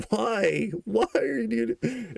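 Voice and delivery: high, squeaky laughing question. Retold or imitated. Still asking the friend why, squeaky with laughter. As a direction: pitch up, cracking with laughter. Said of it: "I just couldn't understand it".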